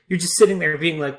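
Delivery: ordinary talk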